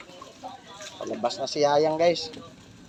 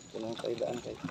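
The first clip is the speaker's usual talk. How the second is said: ordinary delivery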